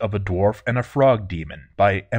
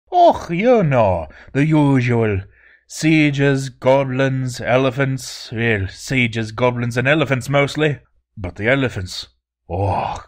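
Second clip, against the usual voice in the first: put-on Scottish accent; 4 semitones higher, 4 dB louder than usual. Voiced dwarf Olin with Scottish lilt.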